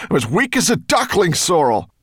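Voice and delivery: strained high calling; weary call back. A narrator's reading. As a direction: shout back, worn out. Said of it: "called back to her wearily".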